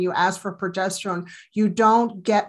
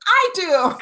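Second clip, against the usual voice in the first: high, loud eager yes; high loud eager reply to Patch Adams; eager volunteer; 10 semitones higher, 6 dB louder than usual. Retold or imitated. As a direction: jump at the chance. Volunteering eagerly as clown doctor.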